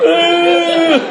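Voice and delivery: loud high burst of laughter; real laughter. Real, not acted. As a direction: crack up at the memory. Laughing at drunk memory.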